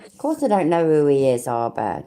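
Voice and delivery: normal talking voice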